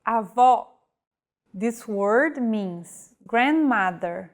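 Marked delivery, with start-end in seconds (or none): 0.1-0.7 Avó stressed on the last syllable with an open O; Avó, open O, stress at the end; open final vowel. Shown as a demonstration. Teacher explaining grandmother, Avó with an open O. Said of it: "stressed and pronounced with open pronunciation".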